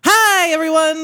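high, loud, bright hello; show-opening greeting. Real, not acted. Host greeting listeners to start the podcast, excited and loud. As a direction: launch the show with a big hello.

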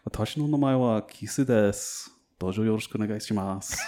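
fluent introduction in Japanese; Japanese accent. Shown as a demonstration. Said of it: "That's a pretty good accent".

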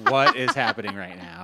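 loud, high-pitched disbelief; shocked. Retold or imitated. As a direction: exclaim it in loud, exaggerated shock. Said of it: "I was so shocked".

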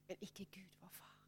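hushed, breathy whisper. Real, not acted. Preacher telling the congregation God never gives up, whispered. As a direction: drop to a near-silent whisper.

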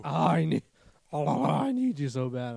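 slow, drawn-out mock yearning; creepy needy cat. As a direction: plead like an obsessed pet, hammed up. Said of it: "it'd actually be creepy".

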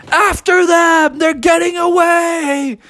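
high, drawn-out alarmed holler; storybook zookeeper's high-pitched cry; chase shout. Acted. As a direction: holler the alarm, stretching the words.

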